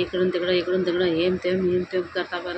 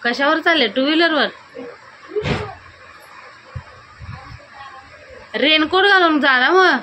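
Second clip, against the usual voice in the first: raised, higher voice calling across the yard; 9 semitones higher, 5 dB louder than usual. Real, not acted. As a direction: call after him as he leaves. Calling out to departing husband.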